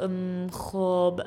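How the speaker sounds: drawn-out, searching filler sounds; halting, very slow thinking aloud; hesitant schoolboy. A narrator's reading. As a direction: stall slowly, hunting for words. Boy stuck for something to say.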